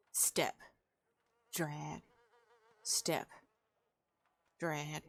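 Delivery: slow dragging rhythm. Acted. Trudging rhythm in the narration.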